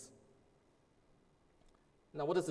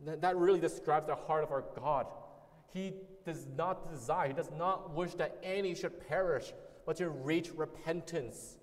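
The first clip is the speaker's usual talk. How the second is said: ordinary talk, nothing marked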